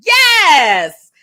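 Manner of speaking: loud, high, drawn-out yes; triumphant cheer. Real, not acted. Cheering the husband who walked away with him, triumphant. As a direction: cheer it out at full voice.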